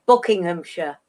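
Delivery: Buckinghamshire ending in a weak -shuh; British -shire ending. Shown as a demonstration. Guest giving an example, Buckinghamshire with reduced -shuh. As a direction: say Buckinghamshire as BUCK-ing-um-shuh, low. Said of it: "pronounce the Shire".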